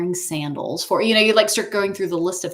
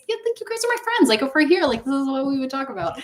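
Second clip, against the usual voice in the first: high, fast, excited gushing; 7 semitones higher, faster than usual. Retold or imitated. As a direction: spill it out fast like you can't hold it in. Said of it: "'Cause I was so excited".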